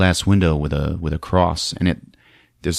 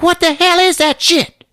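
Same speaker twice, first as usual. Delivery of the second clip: very high, loud outraged shriek; shrieking woman; 3 dB louder than usual. Retold or imitated. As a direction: squeal it like a scandalized lady. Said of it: "She's like".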